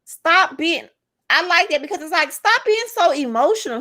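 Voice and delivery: high-pitched mock telling-off; mock scolding. Woman mimicking a retort to emotional men, mock scolding.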